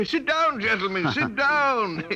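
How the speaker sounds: nervous, overeager welcome; eager host. Acted. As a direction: hurry the guests into their seats. Host urging guests to sit.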